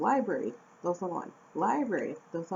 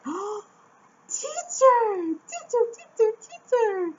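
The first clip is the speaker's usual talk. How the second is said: put-on much higher, louder kid voices calling teacher; excited kids; 7 dB louder, slower than usual. Imitating her excited students calling out to her, high kid voices. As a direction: squeal it like eager little students. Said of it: "they're always like".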